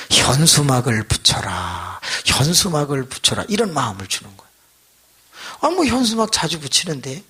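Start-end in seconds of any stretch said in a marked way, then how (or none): 0.1-2.0 slow divine command. Retold or imitated. God's voice in prayer.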